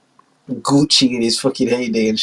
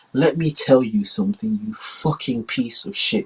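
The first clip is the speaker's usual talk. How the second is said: slow, soft threat; quiet, slow menace; menacing China; 5 dB quieter than usual. Acted. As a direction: slowly lay down the law. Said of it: "China, like".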